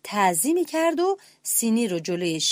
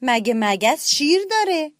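surprised; 5 semitones higher, 4 dB louder than usual. A narrator's reading. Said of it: "با تعجب گفت".

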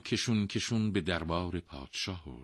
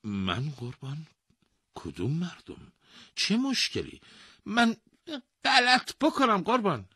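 rising, higher astonished questions; disbelief and surprise; 3 dB louder than usual. Acted. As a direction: sound stunned and indignant. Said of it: "با ناباوری و تعجب پرسید".

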